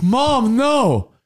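high, pleading; mock whine at mom; whiny kid. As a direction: beg like an embarrassed kid. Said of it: "he's like".

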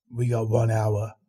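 low and deliberate, marking the deadline; Coffee Man voice. A narrator's reading. Coffee Man warns the narrator of the time, low and slow.

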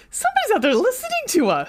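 mock amazed inner voice. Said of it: "part of us is just like".

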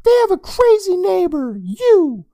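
put-on very high, snarky boy; annoyed kid voice. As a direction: jab back sarcastically. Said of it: "with annoyance".

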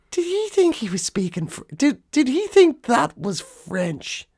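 high-pitched, puzzled disbelief; incredulous. Acted. Comedian wondering about the footballer's accent, incredulous. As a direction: stumble into it, astonished.

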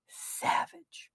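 whispered name. Real, not acted. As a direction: breathe the name softly. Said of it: "Article by Charlie Savage".

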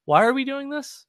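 high, whiny disbelief; exasperated student. Retold or imitated. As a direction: play a fed-up art student asking why. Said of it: "everybody's looking around going".

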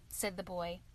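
ordinary delivery

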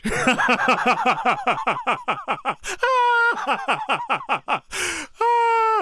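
long, very high cackling laugh; helpless high laughter. Real, not acted. Speaker cracks up at the TikTok punchline, high laughter. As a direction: lose it completely at the joke.

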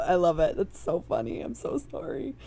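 ordinary talk, nothing marked

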